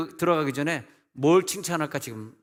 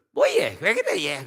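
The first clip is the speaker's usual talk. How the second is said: put-on Gyeongsang accent; 4 dB louder, slower than usual. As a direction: caricature a Gyeongsang speaker, pitch leaping up. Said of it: "경상 사람들은".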